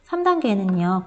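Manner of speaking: normal talking voice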